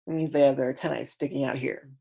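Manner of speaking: ordinary talk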